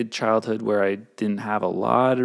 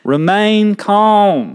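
loud, slow, higher Southern drawl; Southern accent; 6 semitones higher, 5 dB louder, much slower than usual. Pretend. Reading the rules aloud for co-host, broad Southern accent. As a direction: draw it out big and Southern. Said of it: "do it in a Southern accent".

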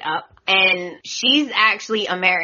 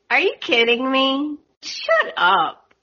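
higher-pitched scoff at a character; exasperated disbelief; 6 semitones higher than usual. Real, not acted. Recapper reacting to Zoe asking Xander, exasperated disbelief. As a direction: react incredulously, pitch jumping up.

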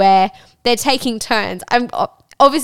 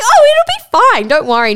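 breezy reassurance; 12 semitones higher, 7 dB louder than usual. Retold or imitated. Reassuring the woman before sex, high and breezy. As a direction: toss off a cheery it'll be fine. Retold reassuring reply to partner.

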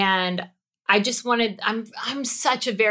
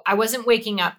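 ordinary delivery

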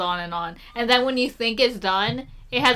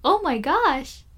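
high, slow, gushing delight; overjoyed; 8 semitones higher than usual. Retold or imitated. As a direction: gasp it out like an amazed kid. Mock grateful passenger reaction.

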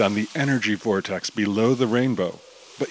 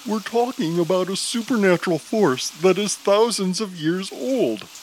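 Chaser voice; 8 semitones higher than usual. Acted. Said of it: "Chaser snorts".

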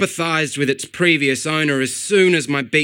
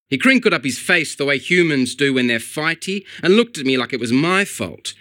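ordinary delivery

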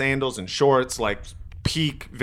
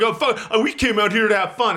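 mocking the bro; 8 semitones higher than usual. Big guy complaining to the high schoolers, high whiny mock bro. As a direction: ham up his complaint. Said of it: "This fucking asshole".

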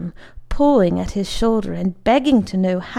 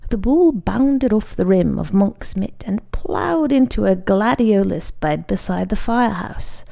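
ordinary delivery

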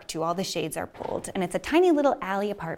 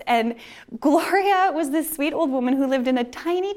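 ordinary delivery

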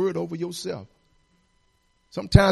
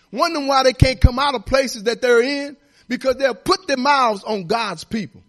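loud, high exhortation; preacher calling the church to believe; full-force preaching; 5 semitones higher, 4 dB louder than usual. Acted. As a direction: drive it home to the pews.

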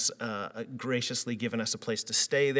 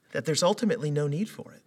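ordinary delivery

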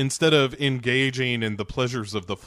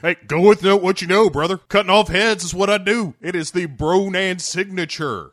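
higher, louder boastful bro; 7 semitones higher, 5 dB louder than usual. Acted. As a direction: brag like a meathead. Speaks as Bronan.

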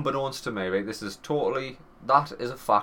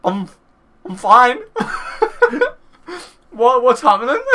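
laughing; 13 semitones higher, 8 dB louder than usual. Retold or imitated. Laughing while retelling.

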